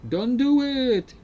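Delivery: high, drawn-out mock wail; mock pleading cry. Streamer pleading with game characters, high drawn-out mock cry. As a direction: plead with exaggerated long vowels.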